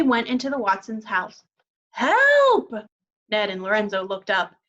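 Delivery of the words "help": high, loud cry for help; faraway shout. A narrator's reading. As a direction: call out from far away. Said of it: "calling for help".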